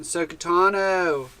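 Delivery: slow, drawn-out surfer accent on Sokotano. Shown as a demonstration. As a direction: stretch Sokotano out like a chill surfer dude. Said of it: "like, a surfer accent".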